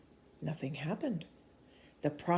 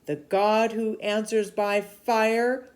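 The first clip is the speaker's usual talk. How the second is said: thundering; 5 semitones higher, 6 dB louder, slower than usual. Acted. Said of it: "Elijah thundered".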